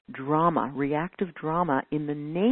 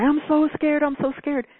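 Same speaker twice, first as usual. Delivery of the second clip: higher, louder mock panic; imitating someone anxious, a bit louder and higher; mock scared whine; 10 semitones higher, 6 dB louder than usual. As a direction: ham up a fearful whine. Mocking anxious people.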